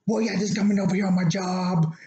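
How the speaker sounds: mimicking the passenger. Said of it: "she's like".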